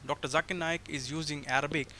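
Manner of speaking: ordinary talk, nothing marked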